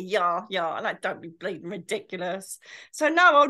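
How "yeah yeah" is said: ordinary talk, nothing marked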